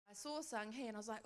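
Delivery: usual speaking voice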